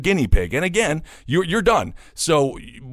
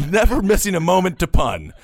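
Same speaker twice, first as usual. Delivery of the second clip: laughing; 6 semitones higher, 4 dB louder than usual. Real, not acted. Laughing at the pun headline.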